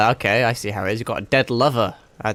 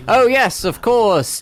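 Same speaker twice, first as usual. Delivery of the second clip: character voice; 11 semitones higher, 5 dB louder, much slower than usual. Acted. Reading character lines in voice.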